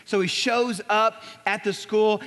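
ordinary talk

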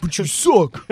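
bad, over-the-top Canadian accent. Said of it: "pretty terrible Canadian accent".

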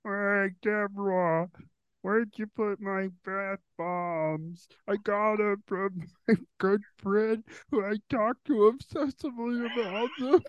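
slow, drawn-out put-on Romano voice; Ray Romano impression. Attempting Ray Romano for the recording, slow and put-on. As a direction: whine it out slowly like Ray. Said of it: "Okay, Ray Romano".

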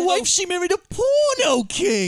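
hammed-up dramatic lament, slow and high; mock movie character. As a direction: deliver it like a self-pitying movie hero. Impersonating movie character's line.

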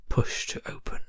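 hushed, eerie whisper; spooky whisper. Acted. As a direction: whisper it like a dread secret. Reading horror sign aloud.

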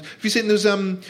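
ordinary talk, nothing marked

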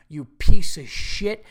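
slow, loud furious insult. Pretend. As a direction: spit the insult out at full volume. Peak of imagined rant.

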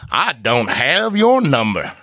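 a badly faked Southern accent, drawn out; mock Southern accent. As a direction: lay on a deliberately bad Southern accent. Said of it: "Southern accent".

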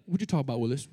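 quick, lightly voiced sitcom catchphrase; a hint of the old sitcom kid; Different Strokes catchphrase. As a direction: toss off the TV line fast. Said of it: "used to see Different Strokes?".

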